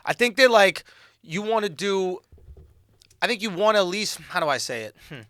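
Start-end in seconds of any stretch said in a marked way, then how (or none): none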